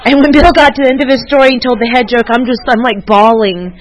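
high, loud, fast and animated; gushing as she relives it; excited retelling. Real, not acted. Telling the group she was bawling, loud and excited.

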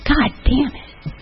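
put-on soft, slow little-kid swear; imitating a toddler cursing. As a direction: say it like a frustrated two-year-old. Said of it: "he said in perfect context".

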